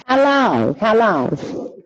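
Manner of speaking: loud, amazed question; astonished repeat of how long. Real, not acted. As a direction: burst out in disbelief. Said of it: "that was only a week".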